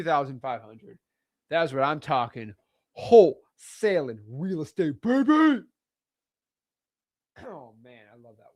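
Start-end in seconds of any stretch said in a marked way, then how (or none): 3.0-5.6 hyping up the wholesaling life; hype tagline. Real, not acted. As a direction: announce it like a catchphrase. Hyped tagline.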